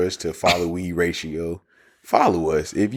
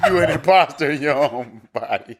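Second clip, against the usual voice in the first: laughing tease; 6 semitones higher than usual. Real, not acted. Friend mocks the confession while laughing.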